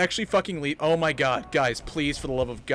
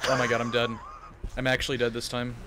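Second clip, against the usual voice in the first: ordinary talk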